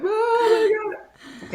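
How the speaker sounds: slow, high crying wail; sobbing. Retold or imitated. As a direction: let the words dissolve into a sob. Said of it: "I'm, like, just sobbing".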